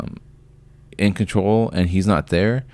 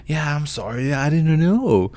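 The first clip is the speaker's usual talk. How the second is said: high, breezy, unconcerned mock voice; mocking the careless owner; 9 semitones higher than usual. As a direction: mimic the neighbor shrugging it off, pitched way up. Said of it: "not concerned at all".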